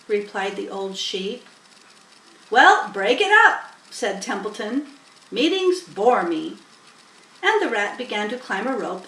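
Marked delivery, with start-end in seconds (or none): 2.5-6.6 loud, bored rat voice; dismissive rat. A narrator's reading. Templeton dismissing the sheep's meeting, impatient. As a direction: brush them all off.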